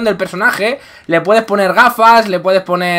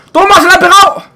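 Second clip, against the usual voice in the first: excited shout; 10 semitones higher, 6 dB louder, faster than usual. Real, not acted. Excited call in fight.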